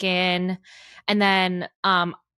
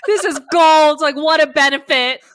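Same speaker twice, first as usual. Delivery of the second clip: higher, louder, thrilled squeal; 8 semitones higher, 9 dB louder than usual. Retold or imitated. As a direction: gush over the perk. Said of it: "I was like".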